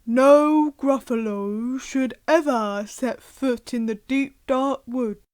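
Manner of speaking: slow, heavy voice of the big Gruffalo father; gruff Gruffalo dad. Acted. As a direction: warn the child like a solemn monster dad. Gruffalo voice in read story.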